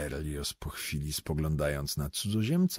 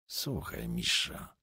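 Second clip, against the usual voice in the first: hushed whisper, softer; 8 semitones lower, 6 dB quieter, slower than usual. A narrator's reading. Poet pulls Misza aside, whispering urgently. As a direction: breathe it close, secretive. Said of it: "szeptał poeta".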